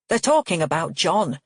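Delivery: plain reading voice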